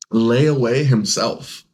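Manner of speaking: normal talking voice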